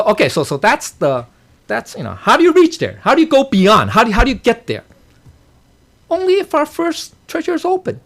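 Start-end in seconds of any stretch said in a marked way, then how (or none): 1.7-4.8 higher, eager questioning; rhetorical questions. Real, not acted. Teacher poses rhetorical questions. 6.1-8.0 answer. Real, not acted. Teacher answers own question.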